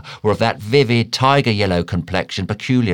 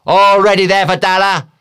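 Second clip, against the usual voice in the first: high, loud hail across a ship; captain's ringing shout; crying out; 12 semitones higher, 8 dB louder than usual. A narrator's reading. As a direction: call it out to the old man at a distance.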